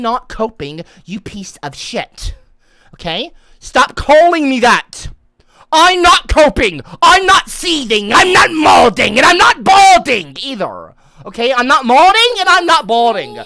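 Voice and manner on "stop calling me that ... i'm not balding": louder, hammed-up indignant denial; loud mock denial. Streamer loudly denies coping to chat. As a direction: insist loudly in fake outrage.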